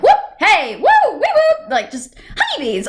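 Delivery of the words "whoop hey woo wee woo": high, loud whoops and yelps; imitated tic noises. Imitating her own Tourette's shouts at the polling place, high whooping tic noises. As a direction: let out random high-pitched shouted noises. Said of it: "all sorts of sounds".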